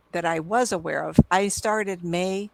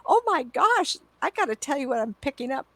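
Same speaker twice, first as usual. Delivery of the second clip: high, eager burst of news; excited; 7 semitones higher than usual. Retold or imitated. Psychic telling a client what she senses, high excited burst. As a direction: bubble over with what you sensed. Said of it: "I'm excitable".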